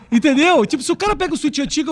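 quick laugh into the question; laugh, then ask through it. Real, not acted. Laughs at own punchline.

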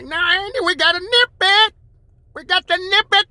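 high, loud jittery deputy voice; Barney Fife impression. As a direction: squeal it like a nervous deputy. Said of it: "Like Barney Fife used to say".

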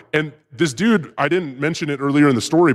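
ordinary talk

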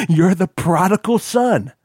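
put-on high, slow old man's relish; grinning gloat. A narrator's reading. Father welcoming back his captive son, grinning gloat. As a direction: draw it out with a grin.

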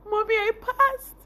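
high, loud cry of joy; joyful shout. Retold or imitated. Running to her mother, a high joyful cry. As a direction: call out the news to mom. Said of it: "I ran and I was like".

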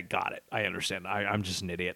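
ordinary delivery

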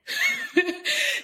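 bright high burst of laughter. Real, not acted. Laugh after realization.

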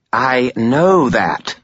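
a dismissive spirit brushing off an order; contemptuous. Acted. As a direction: drag it out with open contempt. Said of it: "his voice contemptuous".

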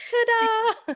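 high-pitched mock ta-da; showy stage flourish. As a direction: sing it out as a performer covering up a disaster. Pretending nothing's wrong on stage.

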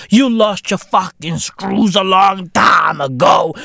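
ordinary delivery

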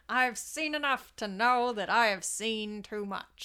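put-on old Hollywood transatlantic accent; silly mid-Atlantic newsreel accent. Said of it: "my silly transatlantic accent".

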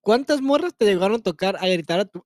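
ordinary delivery

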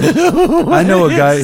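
high, loud, drawn-out put-on voice; little guy's voice. Little guy next to him offers help, high loud comic imitation. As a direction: pipe up slowly as an eager little sidekick.

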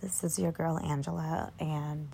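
groggy just-woke-up morning voice; sleepy morning voice from bed. Real, not acted. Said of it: "this is my morning voice".

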